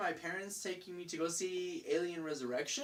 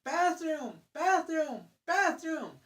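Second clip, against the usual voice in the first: put-on higher kid's repeated chant; lightly mocking kid, higher-pitched; impatient kid; 7 semitones higher, 4 dB louder, much slower than usual. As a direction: whine it like a kid who has to go. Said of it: "one of them starts saying".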